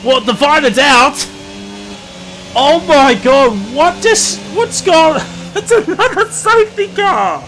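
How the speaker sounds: high, loud, laughing disbelief; shocked shouting. Real, not acted. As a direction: cry out at the sudden crash. Crash and safety car.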